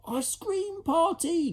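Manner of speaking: high, slow delighted cry; child thrilled; excited cry. Acted. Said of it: "cried one".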